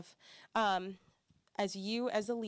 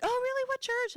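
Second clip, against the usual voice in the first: very high, louder surprised question; curious local; 6 dB louder than usual. Retold or imitated. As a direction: squeak out the question like a startled stranger. YMCA member asking.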